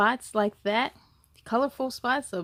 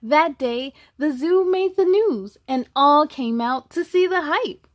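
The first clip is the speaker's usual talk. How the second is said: louder, lively storybook narration; reading to children; 3 semitones higher, 8 dB louder than usual. A narrator's reading. Reading the zebra story to kids, lively and louder. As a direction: tell it brightly to the little ones. Reads the picture book aloud.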